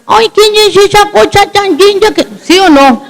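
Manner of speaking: put-on high, cooing baby talk to a toddler. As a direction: coo it like a grandma doting on a baby. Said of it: "uno tiene la tentación de coger y decirle".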